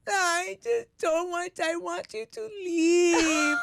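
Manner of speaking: put-on high, slow whimpering little girl; dad imitating his daughter crying for him to leave; whiny crying child. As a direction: whine it like a kid near tears. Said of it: "And she's just like".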